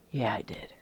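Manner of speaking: confessing a past arrogance under her breath; sheepish whisper. Real, not acted. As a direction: own up in a guilty whisper. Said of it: "Can you believe that, me?".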